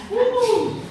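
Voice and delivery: high loud woo; excited whoop. Real, not acted. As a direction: whoop with delight. Gushing about a fine man.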